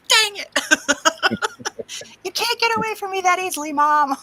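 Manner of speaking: put-on high, fast teasing kid voice. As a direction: gloat like a kid who caught mom sneaking out. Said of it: "And they're like".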